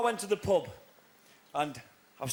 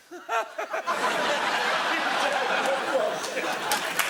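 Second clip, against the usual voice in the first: monkey laugh; 5 semitones higher than usual. Retold or imitated. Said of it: "the monkey went".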